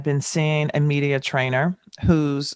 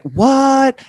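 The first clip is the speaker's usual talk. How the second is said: incredulous; 10 semitones higher, 7 dB louder than usual. Real, not acted. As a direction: exclaim in disbelief. Excited about [name].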